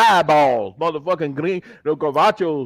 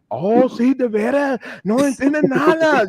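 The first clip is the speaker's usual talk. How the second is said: lightly voiced teasing friends, a bit higher; mocking friends; 6 semitones higher than usual. Relatives mocking his broken Spanish, lightly higher. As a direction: tease him like the family did. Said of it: "They like".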